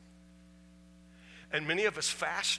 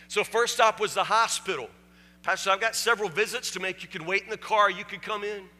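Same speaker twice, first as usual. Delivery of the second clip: rising, louder and louder, preaching the turn; preaching at full force; 8 semitones higher, 10 dB louder than usual. Acted. Preacher to his congregation, climbing from storytelling to full-voiced preaching. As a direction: build the story up to a shout for the congregation.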